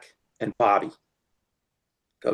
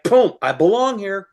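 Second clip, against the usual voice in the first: triumphant glee; 9 semitones higher, 6 dB louder than usual. Retold or imitated. Realizing he fits in.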